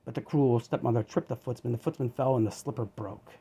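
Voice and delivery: usual speaking voice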